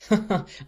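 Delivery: put-on bright burst of girlish laughter; a short merry laugh; merry peal of laughter. A narrator's reading. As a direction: let the laugh spill out. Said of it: "burst into a merry peal of laughter".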